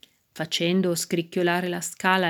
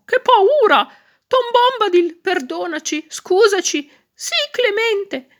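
very high, loud squeaky begging; frightened pleading; 14 semitones higher, 6 dB louder than usual. A narrator's reading. Badgers begging Tom for forgiveness, terrified high squeal. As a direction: plead for mercy in panicked little badger voices.

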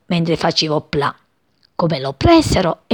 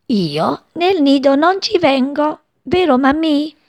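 chick asking; 8 semitones higher than usual. A narrator's reading. Chick asks mother.

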